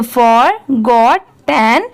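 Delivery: "forgotten" very slow, split as for, got, ten; in three parts. Shown as a demonstration. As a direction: pause between each part of "forgotten": for... got... ten. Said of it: "जब इन तीनों को साथ में मिला देंगे". ("forgotten" को तीन टुकड़ों में, रुक-रुककर धीरे बोलें)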